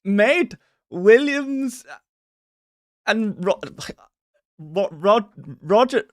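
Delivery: halting, high fake Swedish accent; clueless attempt at a Swedish accent; bad Swedish accent. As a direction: fumble a Swedish accent, slow and loud. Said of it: "how to do a Swedish accent".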